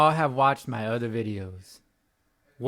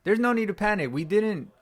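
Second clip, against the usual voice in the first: higher, urgent insistence; fired up; 7 semitones higher than usual. Real, not acted. Fan ranting at viewers after the loss, heated and insistent. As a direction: press the point hard, rising up.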